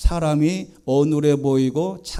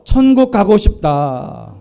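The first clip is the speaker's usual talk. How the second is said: louder, slowed, earnest declaration; preacher leading the church in declaring a longing for heaven, louder and slow; repeat-after-me declaration; 6 dB louder, slower than usual. Shown as a demonstration. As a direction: proclaim it for them to echo. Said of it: "한번 따라해 보겠습니다".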